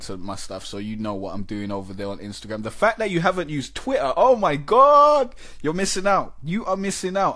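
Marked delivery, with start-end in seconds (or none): 2.6-7.4 oh my God, much higher and louder; excited disbelief. Real, not acted. Host telling caller she's missing Twitter, excited disbelief. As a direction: burst out in amazed disbelief.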